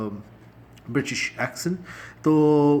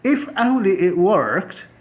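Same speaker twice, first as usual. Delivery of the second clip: drawn-out sigh of regret; slow wistful demo; 6 semitones higher, 7 dB louder, much slower than usual. Shown as a demonstration. Teacher demoing a wishful English phrase, slow and drawn out. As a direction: stretch it out like a hopeless wish. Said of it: "تو آپ کہہ سکتے ہیں".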